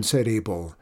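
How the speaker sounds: usual speaking voice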